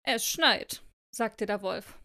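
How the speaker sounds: expressive read-aloud with deliberate phrasing; story narration. A narrator's reading. Reading a snowflake fable to co-host, expressive storytelling.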